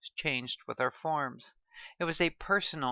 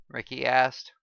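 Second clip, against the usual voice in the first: usual speaking voice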